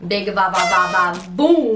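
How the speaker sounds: sing-song ba ba ba boom; bouncy sound effect; playful ba ba boom. Real, not acted.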